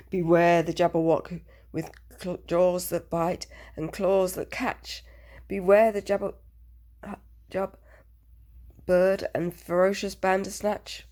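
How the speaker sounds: booming, ominous recital of the warning; dramatic warning. Acted. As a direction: intone it like a stern old warning.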